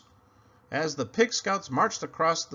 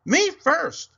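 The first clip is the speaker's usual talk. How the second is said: high, loud piggy cry of me first; cried out; 14 semitones higher, 9 dB louder than usual. Acted. Pig pushing ahead, cries out loud. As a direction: shout to be first in line. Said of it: "he cried".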